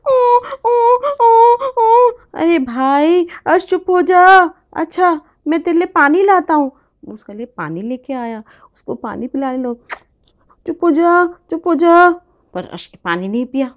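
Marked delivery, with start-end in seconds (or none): 0.1-6.7 put-on high, loud cooing at the baby; loud soothing. 10.6-12.1 put-on loud, high calls to hush a crying baby. Big brother loudly hushing his crying baby brother.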